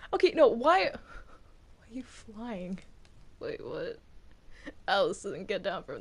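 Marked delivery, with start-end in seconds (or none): none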